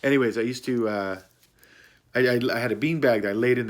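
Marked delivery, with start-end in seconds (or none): none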